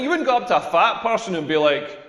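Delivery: ordinary talk, nothing marked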